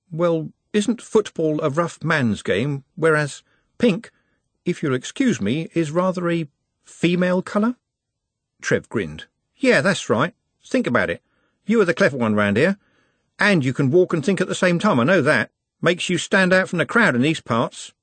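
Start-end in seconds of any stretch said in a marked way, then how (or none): 0.1-7.8 high, careful, hesitant objection; politely doubtful Nut. A narrator's reading. 9.6-17.9 higher-pitched street-lad know-it-all; cocky Trev, explaining. A narrator's reading.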